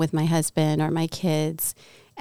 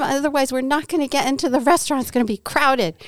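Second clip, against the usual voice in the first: higher, rushed complaint about the crowd; retold anxious nagging; 7 semitones higher, faster than usual. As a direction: fret fast at the husband. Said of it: "I was so angry at him".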